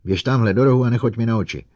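high, loud furious order; shrieking at robot. A narrator's reading. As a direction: scream it to drive him away. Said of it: "vřískla na robota".

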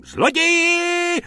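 very high, slow shriek; shrieking thief. Acted. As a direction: scream out at a thief. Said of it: "rozječila se paní".

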